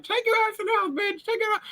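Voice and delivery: voicing the bird, very high; high squeaky bird voice. Bird telling the friend to go inside, high squeaky voice. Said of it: "that bird is like".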